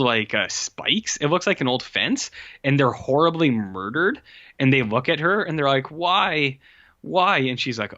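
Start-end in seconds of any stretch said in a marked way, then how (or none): 5.9-7.4 anguished cry. Acted. Dying crash victims ask girl.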